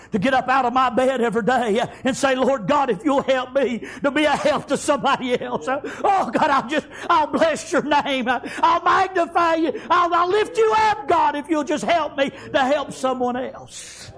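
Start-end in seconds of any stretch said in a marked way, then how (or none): 2.3-14.1 higher, slow, rising prayer; earnest prayer swelling to a peak; fervent prayer. Retold or imitated. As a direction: plead with God, lifting each line. Preacher quoting his own prayer.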